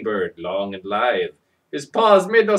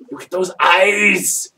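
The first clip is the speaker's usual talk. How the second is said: loud, slow, eager exclaim; excited; 6 semitones higher, 7 dB louder, slower than usual. Acted. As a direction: point and marvel. Excited scare.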